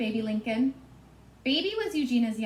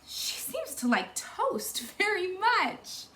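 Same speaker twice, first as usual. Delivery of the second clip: higher, slow, wide-eyed sweetness; 8 semitones higher, slower than usual. Acted. Baby Lincoln watching the piglet eat toast, high and slow. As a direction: marvel at the hungry piglet.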